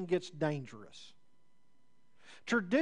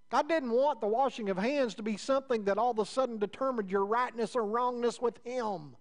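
raised, louder preaching voice, pressing each point; fervent preaching; 5 semitones higher, 3 dB louder than usual. Acted. Preacher pressing the crowd, raised and insistent. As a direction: preach it out to the congregation.

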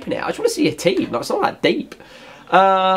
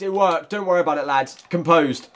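usual speaking voice

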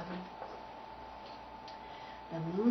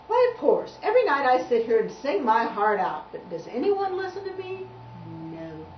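bullfrog voice; 3 semitones higher, 7 dB louder than usual. Acted. Frog complains.

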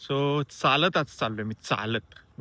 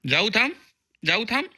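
coaxing; 10 semitones higher, 6 dB louder than usual. Real, not acted. Answering the cat, high.

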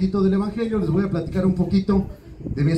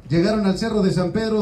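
usual speaking voice

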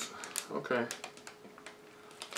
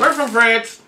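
high loud delight; excited; 9 semitones higher, 11 dB louder, slower than usual. Real, not acted. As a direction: announce it gleefully. Excited discovery.

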